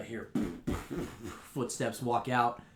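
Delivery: usual speaking voice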